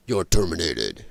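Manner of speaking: very low action-hero voice, slipping toward Stallone; Arnold Schwarzenegger. Retold or imitated. Retrying Schwarzenegger's catchphrase, very low botched impression. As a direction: sink way down for a botched Austrian tough guy. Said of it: "that was almost Stallone".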